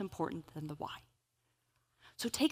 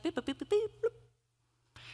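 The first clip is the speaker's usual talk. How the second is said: imitated phone beeps; 12 semitones higher, slower than usual. Imitating phone typing sounds.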